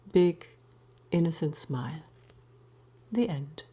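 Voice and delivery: ordinary delivery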